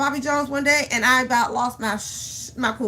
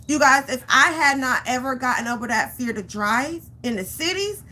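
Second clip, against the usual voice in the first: ordinary delivery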